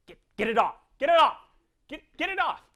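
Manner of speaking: half-joking cry of disgust; mock disgusted yell. Recoils from beady eyes.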